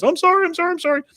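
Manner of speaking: panicked high-pitched apologies; Bill. Acted. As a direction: beg off in a hurried mock squeal. Bill apologizing under threat from Hank.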